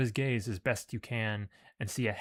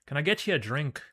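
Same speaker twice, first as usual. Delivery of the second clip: Irish brogue; 4 semitones higher, 4 dB louder, faster than usual. Acted. Irish giant keeps his voice.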